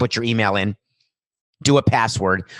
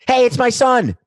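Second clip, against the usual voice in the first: high, loud, delighted shout; excited proud dad; 12 semitones higher, 8 dB louder than usual. Pretend. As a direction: burst out proud and thrilled.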